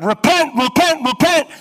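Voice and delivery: very loud, high ringing cry of repent; full-throated prophet's yell; desperate shout. Retold or imitated. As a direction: bellow it up from the bottom of a well. Jeremiah shouting from the well.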